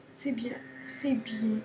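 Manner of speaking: slow, whispered self-praise. Real, not acted. Player quietly congratulating himself, slow whisper. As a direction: breathe it to yourself.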